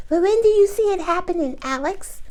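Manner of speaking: squeaky high impatient fan; timeline junkie voice. Acted. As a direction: pester for a date like an impatient fan. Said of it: "That's my timeline junkie voice".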